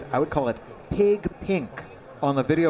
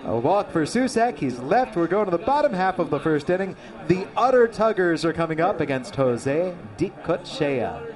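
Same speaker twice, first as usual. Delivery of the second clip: play-by-play; 3 semitones higher than usual. Acted. Live baseball call.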